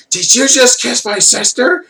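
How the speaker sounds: very high falsetto, slowly drawn out; hammed-up squeaky woman confronting a man; mock outraged sister. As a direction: imitate an indignant sister in falsetto. Voices the other twin confronting wrestler.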